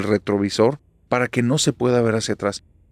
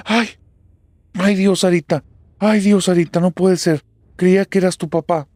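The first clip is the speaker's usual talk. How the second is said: high, loud, shaken outcry; panicked relief; 8 semitones higher, 6 dB louder than usual. Acted. Mother who mistook daughter for dead father, crying out in panic. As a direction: cry out as if badly startled.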